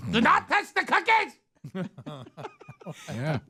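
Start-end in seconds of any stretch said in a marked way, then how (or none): 0.1-1.3 very high, laughing mock German scold; mock German granny. Host as German grandma guarding cookies, high mock scold.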